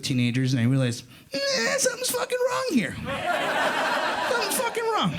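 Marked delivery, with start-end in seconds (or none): 1.3-5.1 high, strained cry of alarm; panicked yelp. Acted. Comic telling the crowd, high panicked cry. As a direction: yelp it out like something's badly off.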